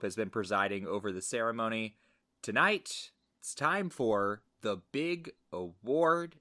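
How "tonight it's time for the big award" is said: slow, dramatic build to the big award; suspenseful awards presenter. Acted. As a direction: drag it out like a gala host. Podcast host mimics awards ceremony.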